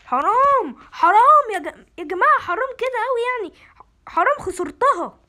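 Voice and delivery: high, loud wail of loss; anguished shout. Real, not acted. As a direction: cry out in despair at losing. Loses the level, cries out. (صراخ حسرة عالي)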